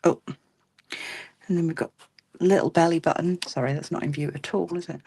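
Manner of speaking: normal talking voice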